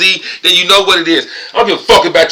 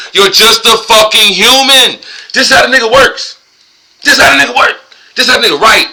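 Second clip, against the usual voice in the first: much higher and louder, hammering it home; a worked-up insistence; fired-up rant; 8 semitones higher, 4 dB louder than usual. Real, not acted. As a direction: preach it with heat. Heated rant about the author.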